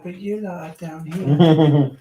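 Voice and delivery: slow, drawn-out pet voice; voicing the dog. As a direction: speak for the dog, slowly. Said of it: "She's like".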